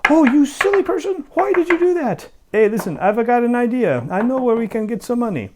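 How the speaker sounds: shrill scolding wife. Acted. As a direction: scold him like an exasperated wife. Wife scolding then idea.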